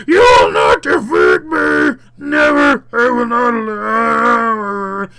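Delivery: shouted, drawn-out monster defiance; harsh demon shriek, long final wail; hissing demon. Acted. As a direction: roar it slowly in a demon voice. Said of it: "it hisses".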